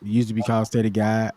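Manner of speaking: ordinary talk, nothing marked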